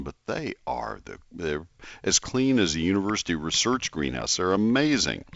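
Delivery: ordinary delivery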